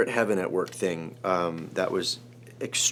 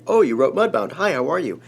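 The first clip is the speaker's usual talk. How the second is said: higher, louder burst of recognition; delighted greeting; 7 semitones higher, 8 dB louder than usual. Retold or imitated. Host recognizing the writer of Mudbound, delighted. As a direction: greet with sudden keen enthusiasm.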